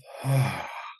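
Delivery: long, audible exhale; clearing sigh. Shown as a demonstration. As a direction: sigh it out through the mouth. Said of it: "to have a clearing breath".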